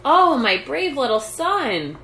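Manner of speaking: high, loud and slow with pride; proudly. A narrator's reading. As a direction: beam with pride at her son. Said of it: "said Missus Little proudly".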